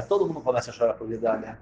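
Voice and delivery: soft, low echo of the line; hushed repeat. Acted. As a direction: drop to a hush, letting the grief sink in. Repeated line, second take hushed.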